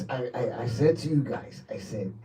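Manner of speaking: low, hushed speech with pauses; mock-solemn telling. Station manager recalling his promise to the guys, low and hushed. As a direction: drop low and soft, as if recounting a prophecy. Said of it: "Why are you saying it like that?".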